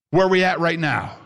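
ordinary talk

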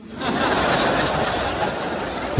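high airy laugh at himself; breathy laugh. Real, not acted. Preacher laughing at his own surprise, airy laugh. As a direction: laugh it off, caught out.

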